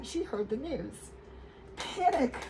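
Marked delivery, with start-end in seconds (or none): 1.8-2.4 higher cry of Panic; shouted panic. A narrator's reading. Narrator calling out the panic. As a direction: call out in alarm.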